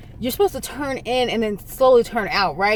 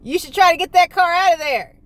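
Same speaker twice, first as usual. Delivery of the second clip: calling out; 7 semitones higher, 4 dB louder than usual. Retold or imitated. Stranger calls advice from road.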